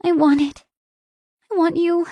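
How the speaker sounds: high, pleading plea; begging. Acted. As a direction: beg him, voice lifted. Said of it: "I begged".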